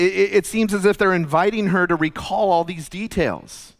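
ordinary talk, nothing marked

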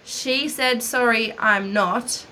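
usual speaking voice

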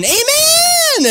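high, loud excited cry of Amen; kid shouting Amen. Retold or imitated. Child calling out in church, shouting Amen. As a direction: belt it out like an eager child. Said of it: "he starts getting up and shouting".

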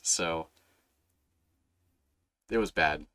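ordinary delivery

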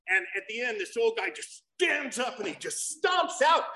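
usual speaking voice

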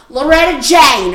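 loud, slow, drawn-out name call; defiant shout. Retold or imitated. Cashier jumping the counter, shouting her name. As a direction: spit the name out slowly in defiance.

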